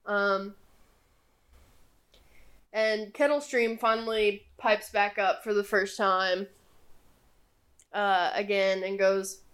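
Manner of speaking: ordinary delivery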